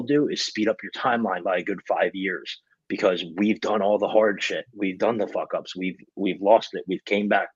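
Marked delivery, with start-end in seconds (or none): none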